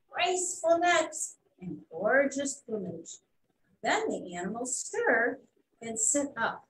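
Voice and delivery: ordinary delivery, nothing marked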